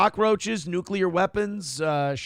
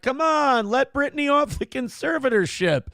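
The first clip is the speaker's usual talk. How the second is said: mock fans pleading; 7 semitones higher than usual. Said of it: "enough fans went".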